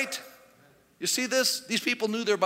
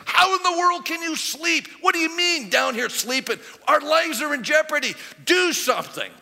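higher, louder, urgent rebuke; alarmed scolding; 6 semitones higher, 4 dB louder than usual. Retold or imitated. Ship captain scolding sleeping Jonah, alarmed and loud. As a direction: shake a sleeper awake in a panic.

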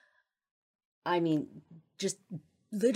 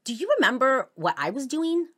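much higher and louder, rushing the middle question; retold angry outburst; 9 semitones higher, 7 dB louder, faster than usual. Him demanding she remember, loud and high. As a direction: hurl the questions back at her, pitched high. Retold quote from a huge argument.

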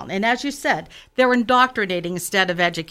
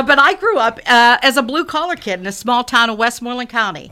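usual speaking voice

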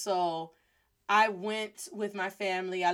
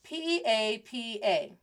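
plain reading voice

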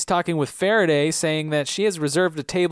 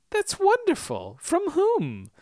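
much higher, sweet mock-surprised mother; slower than usual. Mrs Hawking feigning delight at the grant, high and prim.

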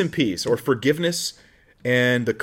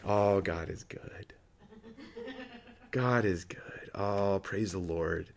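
put-on slow, soft and hushed, the calm of an old believer; serene old saint; 3 semitones lower, 9 dB quieter, much slower than usual. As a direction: let a contented old saint murmur his praise slowly. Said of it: "like an old smiling saint".

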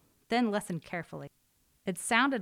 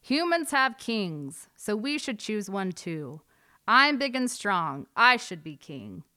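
big proud eagle voice, loud and slow; shouting Eagle; 4 semitones higher, 7 dB louder than usual. A narrator's reading. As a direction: boast loudly from the top of the tree.

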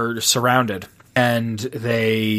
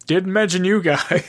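raised voice breaking into laughter; amused retelling; 5 semitones higher than usual. Retold or imitated. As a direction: deliver the quoted punchline, tipping into a laugh. Retold joke, laughs after it.

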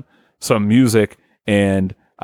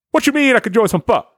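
high, fast, indignant comeback; quick mock-offended movie tough guy; blaxploitation hero; 14 semitones higher, faster than usual. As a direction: toss it back in a high-pitched, incredulous jive voice. Acting Fro's reply in blaxploitation pitch.

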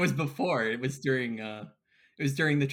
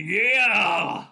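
loud party whoop; triumphant shout; 4 semitones higher, 8 dB louder than usual. Acted. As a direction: whoop it after the chug. Cheers after chugging.

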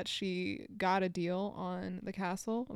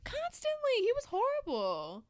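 indignant. Real, not acted. Venting about a villain.

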